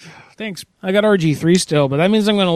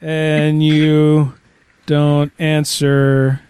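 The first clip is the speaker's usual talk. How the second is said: reading as he types; slower than usual. Acted. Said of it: "Let me write that".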